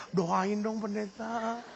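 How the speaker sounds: lightly voiced soft, low plea; a hint of a quiet, wistful request to the pastor; pleading single congregant. Unmarried woman begs pastor for prayer, mildly pleading and soft. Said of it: "setiap kali ketemu dia".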